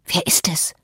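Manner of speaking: hushed, voiceless whisper. A narrator's reading. Ellen asks Cathy through the locked door who is coming, whispered. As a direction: whisper the question. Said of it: "flüsterte ich".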